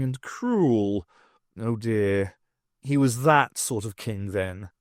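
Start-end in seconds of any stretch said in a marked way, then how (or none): none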